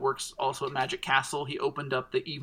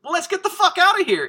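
much higher and louder, urgent; urgent getaway shout; 9 dB louder than usual. Retold or imitated. Landis to the narrator after the crash, a high loud urgent shout. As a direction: bark it like a getaway driver.

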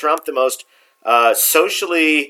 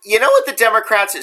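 voice cracking; 7 semitones higher than usual. Real, not acted. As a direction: voice breaks on a high note. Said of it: "sorry for my voice cracking".

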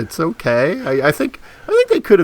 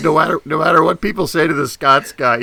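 ordinary delivery

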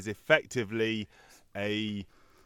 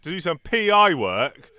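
advert pitch; 5 semitones higher, 6 dB louder than usual. Pretend. Hypes co-host like an advert.

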